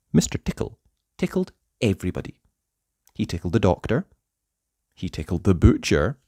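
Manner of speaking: ordinary delivery, nothing marked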